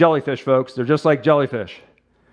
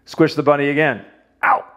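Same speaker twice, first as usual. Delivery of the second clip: ordinary talk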